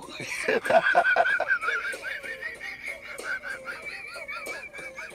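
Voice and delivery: long high laugh. Real, not acted. Laughing at his story.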